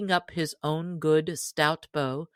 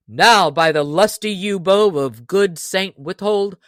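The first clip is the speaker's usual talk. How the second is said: loud, amazed oath; cried out; 2 semitones higher, 8 dB louder than usual. Acted. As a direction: swear loudly in admiration. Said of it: "cried the stranger".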